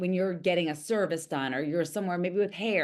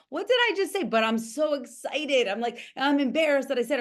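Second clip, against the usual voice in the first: higher-pitched imitation of flustered excitement; self-mocking excitement; 6 semitones higher than usual. Coach replaying her co-host's candid admission, mock-flustered and excited. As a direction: mimic someone giddily embarrassed at themselves. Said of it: "laughing at yourself and being like".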